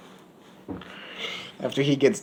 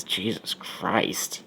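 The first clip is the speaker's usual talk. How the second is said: slow and much softer, almost breathed; stunned murmur; 5 semitones lower, 14 dB quieter, much slower than usual. Acted. She hears he spent fifteen minutes there, stunned soft murmur. She reacts again in shock.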